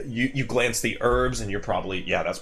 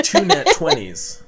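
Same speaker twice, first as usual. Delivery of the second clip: laughing it out, high and loud; real laughter, a joke on the players; 7 dB louder than usual. Real, not acted. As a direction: burst out laughing as you announce the roll. GM laughs announcing a roll.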